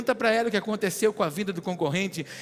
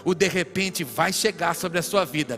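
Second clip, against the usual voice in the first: prophesy over the crowd, rising to full force; fervent preaching; 3 semitones higher, 8 dB louder than usual. Real, not acted. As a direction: build from emphatic declaration into stretched, shouted proclamation.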